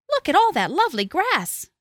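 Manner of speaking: high, eager little voice; little goat. A narrator's reading. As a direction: marvel like a small goat. Little goat admires the grass.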